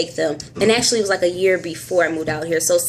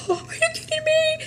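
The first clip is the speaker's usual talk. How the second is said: much higher, squealing with delight; thrilled disbelief; 3 dB quieter than usual. Retold or imitated. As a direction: gasp and squeal it like great news. Reacting to good lab news.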